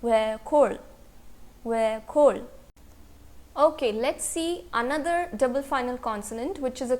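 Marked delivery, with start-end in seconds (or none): 0.0-2.4 "वेखोल" as we-khol, ending only on an L; pronunciation demo. Shown as a demonstration. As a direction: model "वेखोल" in two careful syllables. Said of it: "तो word का pronunciation क्या होगा?". ("वेखोल" का उच्चारण वे-खोल, अंत में ल)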